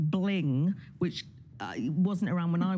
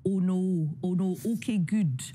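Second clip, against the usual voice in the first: Scottish vowels with lips pushed out, slowed down; Scottish accent. Shown as a demonstration. Teaching a movie star the Scottish vowels. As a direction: round out the Scottish O A U sounds. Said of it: "a wee trinity of sounds".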